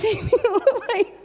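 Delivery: high, laughing delight. Real, not acted. Woman telling church about healing, laughing with joy. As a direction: laugh through the words with joy.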